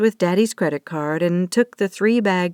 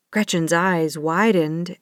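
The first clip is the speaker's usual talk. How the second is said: ordinary delivery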